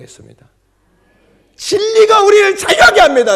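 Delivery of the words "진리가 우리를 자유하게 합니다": loud, high proclamation; shouted preaching. Acted. Preacher proclaiming to the church, shouting. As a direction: ring it out to the room.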